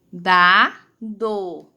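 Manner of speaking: low, two spaced syllables; sílabas separadas. Shown as a demonstration. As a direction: sound out dado as DA-DO. Teacher sounds out possible word. (leitura silabada)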